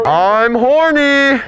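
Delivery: drawn-out high shout. Acted. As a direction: yell it out slowly. Yelling at the store clerk.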